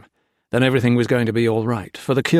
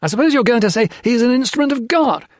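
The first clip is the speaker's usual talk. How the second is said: sneering; 13 semitones higher, 6 dB louder, faster than usual. A narrator's reading. Peter's mocking sneer.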